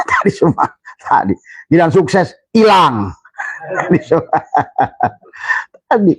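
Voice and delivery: laughing through the words; amused breathy laugh. Real, not acted. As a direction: chuckle at human nature. Laughing aside.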